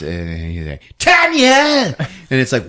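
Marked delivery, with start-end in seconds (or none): 1.0-2.0 high, loud cry of the name; sudden theatrical yell; mock monster shout. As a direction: holler the name like a hammed-up villain. Cat man calls the victim.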